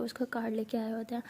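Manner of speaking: ordinary delivery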